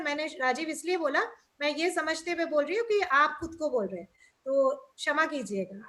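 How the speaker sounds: ordinary talk, nothing marked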